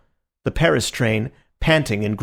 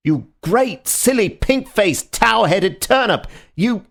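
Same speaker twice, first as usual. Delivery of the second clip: insult tirade; 10 semitones higher, 4 dB louder, slower than usual. A narrator's reading. Marquis continuing insult tirade.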